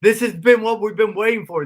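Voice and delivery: this is the big moment; excited. Retold or imitated. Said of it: "And I'm like".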